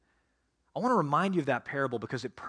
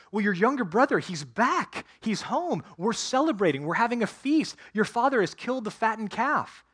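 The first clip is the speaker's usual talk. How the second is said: higher and louder, eager news of the feast; excited servant; 7 semitones higher, 4 dB louder than usual. Acted. Servant telling the older brother about the feast, excited and higher. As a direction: blurt out the good news like a thrilled servant.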